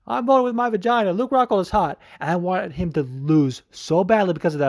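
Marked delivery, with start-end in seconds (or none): 0.1-2.0 high mock swooning voice; mocking the girls. Mocking the girls' crush on the fighter, high mock voice. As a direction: mimic them swooning over a hot fighter. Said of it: "they were like".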